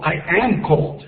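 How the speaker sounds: I am, drawn out slowly; slow demo. Shown as a demonstration. Teacher to Spanish-speaking students, slowly modelling English. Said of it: "teacher demonstrating English phrase".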